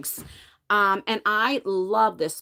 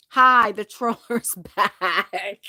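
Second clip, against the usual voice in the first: laughing greeting; 5 dB louder, slower than usual. Real, not acted. Greeting a troll in chat.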